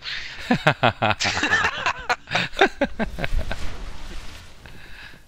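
real giggling laugh fit; long high laugh. Real, not acted. Laughing at teammate's shots.

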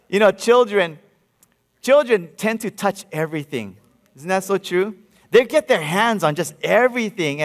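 normal talking voice

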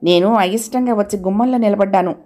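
ordinary delivery

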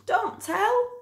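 very high, hushed teddy voice; high little bear asking; secret plea. Acted. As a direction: plead for a secret. Bear asks boy for secrecy.

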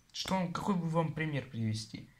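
ordinary talk, nothing marked

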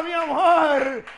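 slow, loud, stretched shout; drawn-out shout. Real, not acted. Host to viewers, stretching the catchphrase into a loud shout. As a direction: hold the word and belt it. Punchline to the nomination news.

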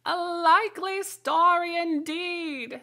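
very high, slow, scornful bird voice; deepest contempt. A narrator's reading. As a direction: sneer at her like a contemptuous pigeon. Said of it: "in a tone of the deepest contempt".

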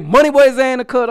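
loud hype host intro. Acted. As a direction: announce yourself like a street interviewer.

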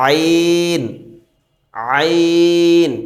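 teacher pronouncing আইন beautifully for students; throaty letter name. Shown as a demonstration. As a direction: squeeze আইন out of the mid throat. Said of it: "সুন্দর করে উচ্চারণ করি".

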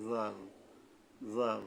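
জাল with the tongue at the upper front teeth, a soft voiced th; soft Arabic dhal. Shown as a demonstration. Teacher demonstrating the letter dhal, soft voiced th. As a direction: ease জাল out gently with a dh sound. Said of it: "নরমভাবে".

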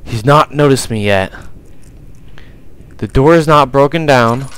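usual speaking voice

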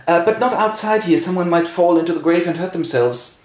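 high, worried caution; head off a fight, voice lifted; fussy king. Acted. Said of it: "And the king goes".

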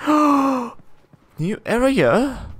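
slow, drawn-out wonder; amazed gasp. Real, not acted. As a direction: gasp then marvel at it. Gasps at discovering new room.

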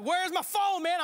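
hollering around the house for a lost phone; mock yell. Man yelling at everyone about his lost phone, loud mock panic. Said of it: "I'm yelling".

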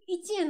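一円 pitched high and loud; Fukuoka intonation. Shown as a demonstration. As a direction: lift 一円 in the Kyushu way. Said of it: "ちょっと上がるところが多いんですよね".

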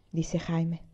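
plain reading voice

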